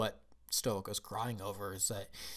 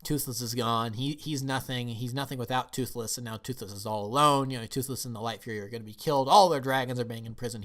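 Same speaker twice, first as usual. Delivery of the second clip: normal talking voice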